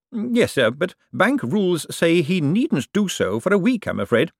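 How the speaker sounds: high, careful subordinate's voice; deferential Bent. Acted. Bent answers his superior about bank rules, deferential and careful.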